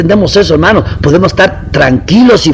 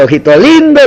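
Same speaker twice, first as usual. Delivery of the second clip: mocking taunt; 7 semitones higher than usual. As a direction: needle him with a sing-song insult. Someone taunts the listener.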